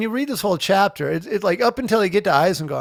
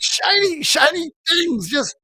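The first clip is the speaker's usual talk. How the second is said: high, slow, wonderstruck; awestruck dwarf voice; 8 semitones higher, slower than usual. Retold or imitated. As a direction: marvel like a child at treasure. Imitating Gimli, awestruck.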